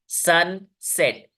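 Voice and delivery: सनसेट as two beats, sun, set; syllable by syllable. Shown as a demonstration. Teacher showing two syllables to students, सनसेट broken in two. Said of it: "दो syllables हैं".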